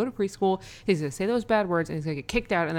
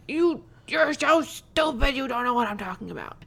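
imitating frustrated son; 7 semitones higher than usual. Four-year-old snapping at mom in the car, frustrated imitation. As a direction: mimic an exasperated small child, stretching the first words. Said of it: "then he gets super frustrated".

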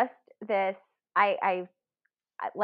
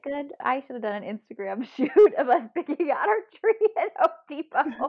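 laughing joke; 7 semitones higher, 4 dB louder than usual. Retold or imitated. Joking about Instagram shoot.

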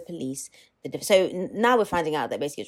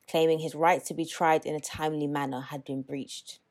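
normal talking voice

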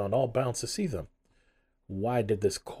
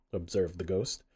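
ordinary delivery, nothing marked

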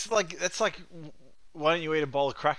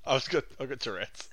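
normal talking voice

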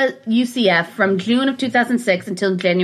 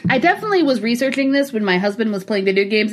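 normal talking voice

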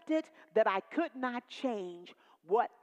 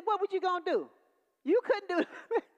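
high, louder retort of a rough mother; tough mama; 10 semitones higher, 6 dB louder than usual. Retold or imitated. Dying mother answers her daughter, a high, louder retort. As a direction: snap back like a tough old mama.

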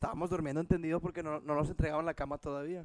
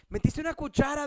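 higher accusing question; mock angry; 8 semitones higher, 5 dB louder than usual. Wife interrogating him about the microwave, mock angry. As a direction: imitate the angry wife, lightly. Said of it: "bien enojada".

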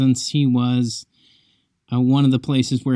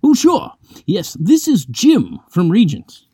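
higher, slow, genteel English voice; old professor voice; 7 semitones higher, 5 dB louder than usual. Retold or imitated. Packer phones the student house, a slow, high English voice. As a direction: play the elderly professor politely leaving a message.

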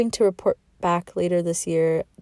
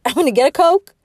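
higher and louder through a laugh, saying "Coke" again; laughing redo; 9 semitones higher, 9 dB louder, faster than usual. Real, not acted. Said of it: "why did I say it like that".

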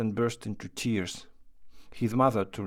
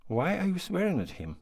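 indignant cry; 7 semitones higher than usual. A narrator's reading. Aunt defends the boy to his mother, crying out. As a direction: cry out a high, scolding question. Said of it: "cried the aunt".

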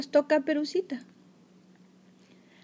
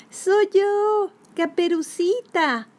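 put-on much higher, slow little girl; 8 semitones higher, much slower than usual. A narrator's reading. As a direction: answer sweetly like a child. Girl answers at door.

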